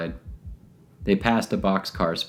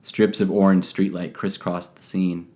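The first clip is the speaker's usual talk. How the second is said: ordinary delivery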